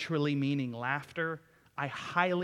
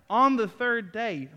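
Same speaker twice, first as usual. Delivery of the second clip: every word drawn out slow and high, louder and ringing; dramatic emphasis; 7 semitones higher, 9 dB louder, slower than usual. Acted. Preacher to congregation, savouring the phrase in a slow, high, loud proclamation. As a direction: savour each word like a revelation.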